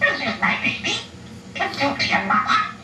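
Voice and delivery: usual speaking voice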